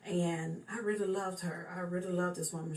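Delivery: normal talking voice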